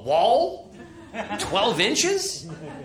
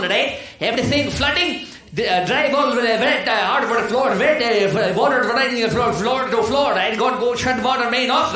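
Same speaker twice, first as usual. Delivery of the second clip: put-on Indian accent; 4 semitones higher than usual. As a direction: rattle it off as a frantic Indian worker. Worker Sanjeet voiced in panic.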